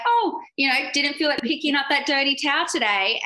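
high sarcastic mimic of a coworker; high snide impression of a crewmate; mocking quote. Mocking Kyle's banter.

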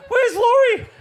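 high loud laughing call; high frantic shouted question. Retold or imitated. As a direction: shout searching the water. Panicked search, retold.

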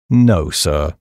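low, measured servant's reply; discreet, low-voiced valet; deferential valet. Valet answering his master in dialogue.

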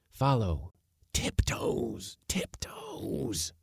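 hushed, breathy tiptoe command; urgent whisper. Acted. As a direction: whisper to keep them silent. Uncle whispering to sneak.

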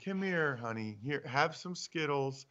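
soft, hushed, sweet-talking; coaxing a child. Pretend. Parent calming a daughter with Skittles, softly coaxing. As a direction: lure the kid over with candy. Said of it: "It's like".

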